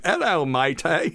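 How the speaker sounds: lightly voiced British accent, slowed; a hint of a British greeting; mock British. Waking up after a stroke, a hint of a British greeting. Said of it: "it's like".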